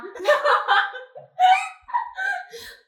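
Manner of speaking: very high, loud shrieking laughter. Real, not acted. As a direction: let a big shriek of laughter go. Loud laughter after punchline.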